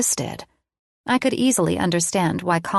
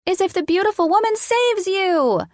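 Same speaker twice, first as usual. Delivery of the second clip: put-on very high-pitched little girl; child's squeal; 13 semitones higher than usual. A narrator's reading. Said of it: "Addison squealed".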